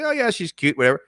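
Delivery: high, offhand shrug of a voice; mock dismissive onlooker; dismissive viewer. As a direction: brush her off like it's nothing. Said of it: "probably say".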